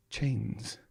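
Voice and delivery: plain reading voice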